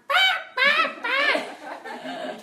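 high loud squawking bah; bird squawk. Retold or imitated. Imitating the bird at the red light, loud squawk. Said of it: "this bird is going".

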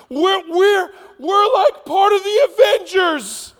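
giddy high-pitched shouting, words stretched out; excited disciples. Acted. Disciples thrilled they are the Messiah's crew, over-the-top excited. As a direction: squeal it out in hammed-up excitement. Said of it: "They're so excited".

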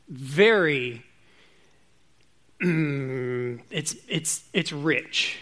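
usual speaking voice

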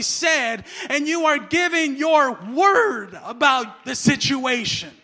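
loud, emphatic, building preaching; full-force preaching. Real, not acted. Preacher urging people to speak for God, loud emphatic preaching.

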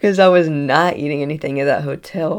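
ordinary talk

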